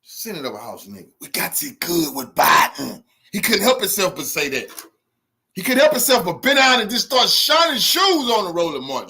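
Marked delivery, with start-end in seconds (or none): 0.0-3.0 mocking the man. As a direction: sneer it back like the man who said it. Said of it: "That's what that man just said".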